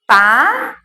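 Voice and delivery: "pá" alone, stretched and rising; sílaba isolada. Shown as a demonstration. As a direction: leave "pá" hanging, waiting for the rest. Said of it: "tá faltando aqui o pel". ("pá" isolado, subindo)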